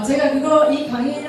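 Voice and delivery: usual speaking voice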